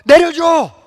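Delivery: high, loud, drawn-out plea for help; acted panicked yell; frightened cry. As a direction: scream like a terrified child stuck up high. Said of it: "너무 무서워가지고 형한테".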